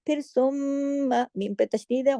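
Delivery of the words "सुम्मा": सुम्मा with a long hummed म्म; big nasal hold. Shown as a demonstration. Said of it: "गुन्ना होगा". (सुम्मा, बड़े गुन्ने के साथ)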